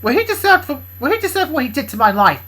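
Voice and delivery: loud, high defiant character voice; angry Bob. Acted. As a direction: spit it back defiantly.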